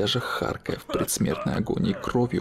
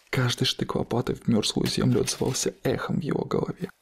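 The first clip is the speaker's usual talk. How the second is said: ordinary delivery, nothing marked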